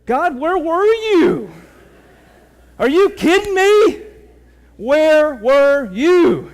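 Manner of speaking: loud, high, slow anguished shout; frustrated outcry at God. Retold or imitated. Player yelling at God after the missed free throw, anguished shout. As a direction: cry out at God, furious and hurt. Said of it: "And I'm like".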